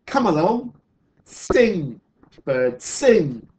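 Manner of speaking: emphatic storybook urging. Acted. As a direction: coax it hard, punching each word. Sue urges the bird again.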